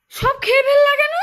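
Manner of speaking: high pitched dismay; dismayed whine. Acted. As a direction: whine in disbelief. Dismayed food is gone.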